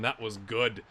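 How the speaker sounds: usual speaking voice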